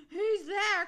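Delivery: loud, high alarmed call; high storybook holler. A narrator's reading. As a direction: call out, startled by a growl. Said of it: "called Olive".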